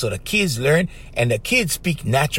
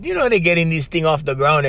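normal talking voice

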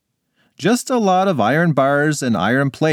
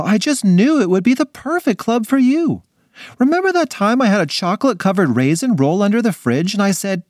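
high, bubbly mom voice; over-the-top cheerful parent; gushing mother; 10 semitones higher than usual. Acted.

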